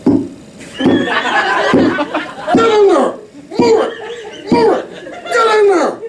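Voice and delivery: high, yelled orders with a laugh; mock doctor shoving. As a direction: shove the baby into place, barking. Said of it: "they just go".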